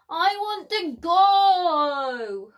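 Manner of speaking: loud, slow, drawn-out whine; a cub sulking at being left behind; hammed-up childish grumble; grumbling cub. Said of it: "Lizzie grumbled to Nana".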